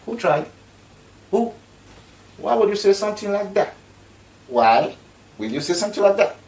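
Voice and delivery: loud, high, outraged disbelief; shocked disbelief. Retold or imitated. Vlogger reacting to the UN official's claim, loud and shocked. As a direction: cry out in shocked disbelief at her words. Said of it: "I was like".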